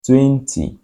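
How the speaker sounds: "Twenty" lifted and punched, then falling; stress on twenty. Shown as a demonstration. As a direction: lean hard into "Twenty" and let it drop. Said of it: "Emphasis on twenty".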